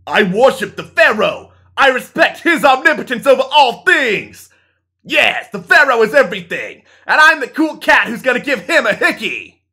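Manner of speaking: loud, high fervent worship; zealous fan voice. Acted. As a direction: declare it like a zealot. Friend declares in play.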